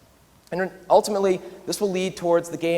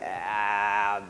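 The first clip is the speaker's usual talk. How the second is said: reluctant grumbling; 6 semitones lower, 3 dB quieter than usual. Retold or imitated. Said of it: "I'm like".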